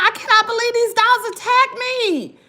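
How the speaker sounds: high, stunned outrage; outraged disbelief. Retold or imitated. At the hospital after the attack, high and outraged. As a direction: let the disbelief spill out. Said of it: "I'm just like".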